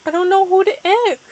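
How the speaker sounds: high, fast mock wail; hammed-up high-pitched lament. A narrator's reading. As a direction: wail it out in a rush. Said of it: "back wailing up".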